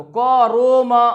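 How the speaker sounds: करूमा drawn out as ko-ro-ma with 'o'; majhul, wrong way. Shown as a demonstration. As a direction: open and stretch करूमा toward o. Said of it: "भी नहीं पढ़ना". (करूमा, मजहूल आवाज़ में)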